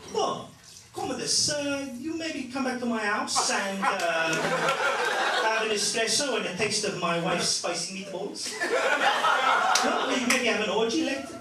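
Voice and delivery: mock Italian Roman, building to a shout; Italian accent. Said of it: "I want them to sound like this".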